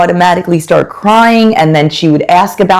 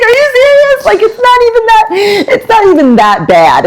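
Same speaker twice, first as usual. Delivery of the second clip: mock disbelief. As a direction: play up the exaggerated disbelief at her tears. Mother incredulous at crying daughter.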